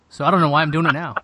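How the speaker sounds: ordinary talk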